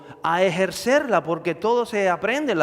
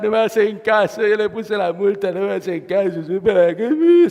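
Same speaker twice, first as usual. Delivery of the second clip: hammed-up whiny sobbing complaint, louder; crying; 3 semitones higher, 5 dB louder than usual. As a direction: whimper it like a crybaby cop. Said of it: "se echa a llorar".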